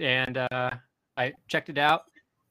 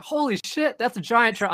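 amazed exclamation; 9 semitones higher, 4 dB louder than usual. Retold or imitated. Neighbor's surprised reaction retold.

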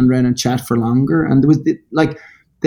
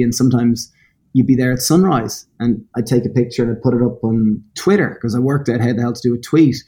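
usual speaking voice

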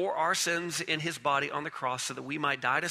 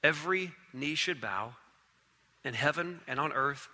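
hushed closing; 4 semitones lower, 8 dB quieter than usual. Acted. Preacher closing in reverence, soft and low. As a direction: let it settle into reverent stillness. Quiet closing over music.

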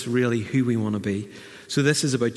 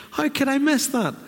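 high, puzzled self-question; light mock astonishment; wondering disbelief; 13 semitones higher than usual. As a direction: marvel at missing it. Imagined thought after realization.

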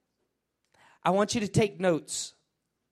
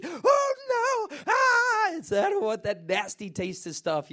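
put-on high squealing child refusing medicine; whiny kid; 11 semitones higher, slower than usual. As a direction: whine like a kid who hates the taste. Kids refusing medicine, mimicked.

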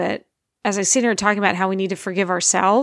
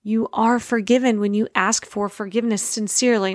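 ordinary talk

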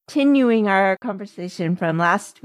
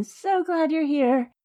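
voice cracking up an octave; 6 semitones higher, 4 dB quieter than usual. Real, not acted. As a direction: voice cracks on a high note. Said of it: "Oh, my voice cracked".

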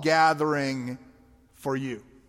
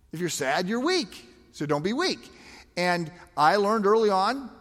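normal talking voice

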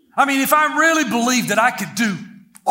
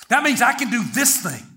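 normal talking voice

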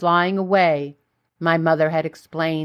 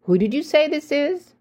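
needling; 7 semitones higher than usual. A narrator's reading. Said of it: "to infuriate her, I asked again".

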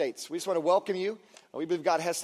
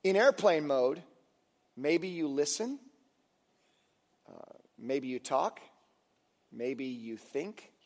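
slowing, softening; 5 semitones lower, 4 dB quieter, slower than usual. Real, not acted. Slowing into reflective quiet.

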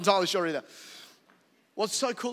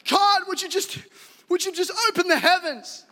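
mock fervent prayer; 13 semitones higher, 8 dB louder than usual. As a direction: ham up a pious prayer.

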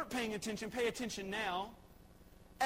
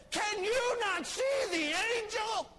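much higher, louder shouted donkey voice; donkey shouting; 11 semitones higher, 5 dB louder than usual. Retold or imitated. Donkey shouts at blind Balaam about the angel. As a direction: cry out in disbelief. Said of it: "but he says".

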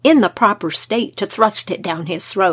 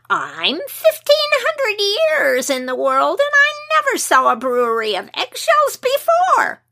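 excited imp voice; 11 semitones higher than usual. Acted. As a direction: squeal it out in ancient delight. Fairy reveals his age in delight.

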